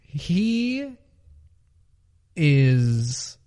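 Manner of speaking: long pauses between the words; deliberate, drawn out. Real, not acted. Host answering cohost's controversial question, slowly and deliberately. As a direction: stretch out each opening word, then land the answer.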